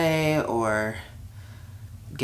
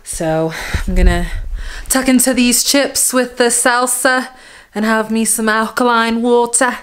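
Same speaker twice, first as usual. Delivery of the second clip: put-on Yorkshire accent; 3 semitones higher, 3 dB louder than usual. Vlogger chatting to viewers about snacks in a light mock Yorkshire accent. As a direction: playfully try on a Yorkshire accent picked up from YouTubers. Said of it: "so I've been putting on this accent".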